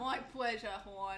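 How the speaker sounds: hammed-up Baltimore accent, hun as horn. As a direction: play a slurring Baltimore server. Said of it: "they don't say hun, they say horn".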